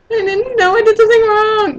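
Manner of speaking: very high-pitched mock whine; whining. Mocking a boyfriend who thinks he did wrong, whining. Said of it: "start whining".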